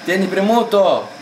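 high, loud, drawn-out order; insistent shout. Real, not acted. Coaching the player on the button, insistent shout. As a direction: call it out high and slow to get it done. Repeats instruction louder to player.

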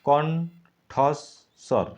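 কণ্ঠস্বর stretched very slowly into syllables; slow syllable split. Shown as a demonstration. Teacher breaking কণ্ঠস্বর slowly for students. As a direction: drag out কণ্ঠস্বর to show its parts. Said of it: "কণ্ঠস্বরকে ভাঙো".

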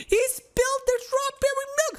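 high, shocked cry over spilled milk; mock outrage at the cat; shocked wail. Real, not acted. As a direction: wail it like a disaster happened. Scolding the cat in the game.

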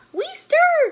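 very high, loud little voices; wee monsters' cry. A narrator's reading. Two baby monsters answering their mother, high cry. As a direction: squeal it back high and loud. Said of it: "cried the two".

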